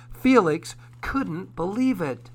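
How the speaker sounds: ordinary delivery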